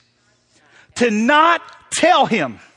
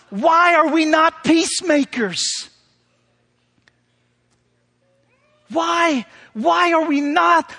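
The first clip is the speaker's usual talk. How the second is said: raised, urgent pulpit appeal, slowing on the questions; fervent preaching; 5 semitones higher than usual. Acted. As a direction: press the congregation with each why. Preaching at full force.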